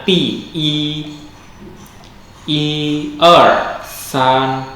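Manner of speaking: i in i er san kept level, first tone, said high; model i er san. Shown as a demonstration. Teacher counts one two three in Mandarin for students, high. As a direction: keep the i flat and high. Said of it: "maka i tetap nada satu".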